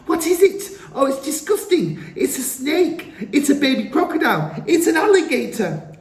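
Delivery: high, loud frightened squeals; children shouting. A narrator's reading. Children shrieking at the creature in the glass. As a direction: shriek like startled kids.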